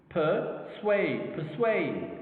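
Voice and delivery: "Persuade" with SUADE prominent and falling; stress on second syllable. Shown as a demonstration. Teacher demonstrating two syllables, "Persuade" stressed on SUADE. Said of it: "is in the second syllable".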